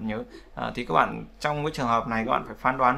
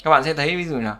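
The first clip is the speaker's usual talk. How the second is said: ordinary talk, nothing marked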